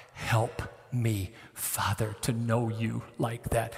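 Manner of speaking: soft, hushed plea to God; quiet prayer. Acted. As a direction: pray it under your breath.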